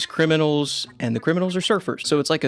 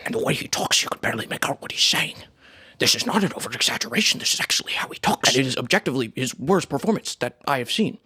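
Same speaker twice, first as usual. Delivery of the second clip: mumbling impression. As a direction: mumble it like Robert Downey Junior. Keeps the mumbling impression going.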